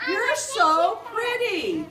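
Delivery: slow, high parrot phrase. Shown as a demonstration. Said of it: "So you say what I say".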